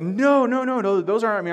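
high, loud, frantic no's; panicked denial. Retold or imitated. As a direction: blurt it out in a rising panic. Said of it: "Bob starts to freak out".

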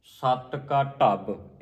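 slow, with a doubled b closing ਢੱਬ; doubled consonants. Shown as a demonstration. Teacher modelling the verse, heavy doubled end on ਢੱਬ. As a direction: weigh on ਸਤ and ਢੱਬ. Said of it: "ਢੱਬ ਤੇ ਵੀ ਅਧਿਕ ਲੱਗੇਗਾ".

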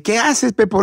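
put-on high kids'-host voice; Chabelo. Kids' host asking the puppet, high character voice. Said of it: "me dice Chabelo".

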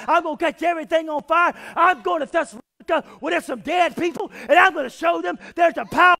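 loud, high-pitched revival sermon; full-force preaching. Acted. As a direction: drive the story home with rising fervor, punching key words.

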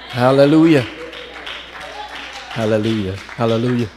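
a rousing hallelujah shout. Real, not acted. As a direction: call it out to the congregation. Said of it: "Do I hear any witnesses out there?".

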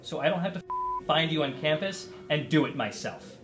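normal talking voice

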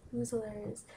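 usual speaking voice

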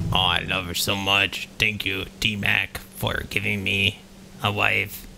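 a lumbering golem, gently besotted; deep creature voice. Acted. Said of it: "that was horrible voices".

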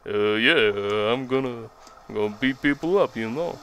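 terrible slurred boxer impression; badly faked mumbling tough guy; Stallone. Boxer answering his trainer, hammed-up slurred impression. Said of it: "That was a terrible Stallone impression".

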